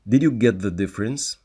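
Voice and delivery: normal talking voice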